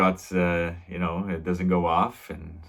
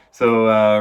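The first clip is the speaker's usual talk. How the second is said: usual speaking voice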